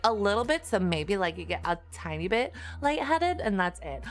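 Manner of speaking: hammed-up Valley Girl explaining to chat. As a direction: lay the Valley Girl talk on thick. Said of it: "So I talk like a Valley Girl".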